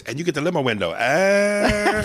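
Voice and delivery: a hint of diva sass; lightly voiced clerk catchphrase; lightly camp hotel clerk. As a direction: slip the catchphrase in. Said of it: "he goes".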